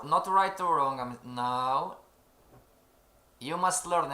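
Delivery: ordinary talk, nothing marked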